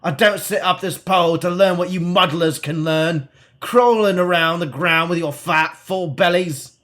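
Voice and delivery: hammed-up bad Cockney hermit sneer, slowing at the end; bad Cockney accent. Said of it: "Bad Cockney accent".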